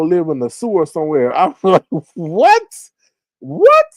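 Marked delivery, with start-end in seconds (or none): 2.1-3.9 high, mock-shocked "what?"; şaşkınlık. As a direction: exclaim in exaggerated disbelief, pitch shooting up. Reacting with mock shock to news.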